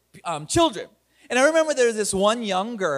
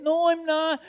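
put-on little girl denying; 7 semitones higher than usual. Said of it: "imitating the girl's reply".

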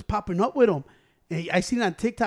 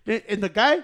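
usual speaking voice

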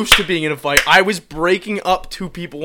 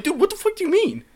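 incredulous retort. Retold or imitated. Retelling a reply to friends.

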